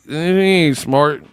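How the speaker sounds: ordinary talk, nothing marked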